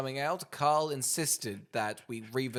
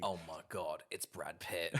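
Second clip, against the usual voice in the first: low, soft, awestruck deadpan; hushed serious hero line; deadpan Statham read; 7 semitones lower, 8 dB quieter, slower than usual. Retold or imitated. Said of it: "if he said that line in Snatch".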